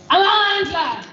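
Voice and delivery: high, loud call to the crowd; rallying cry. Real, not acted. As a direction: shout the slogan out to rally everyone.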